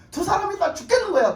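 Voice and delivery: high, loud peak of the sermon; preaching cry. Real, not acted. Preacher crying out to the congregation, loud and high. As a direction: cry out their pain to the crowd.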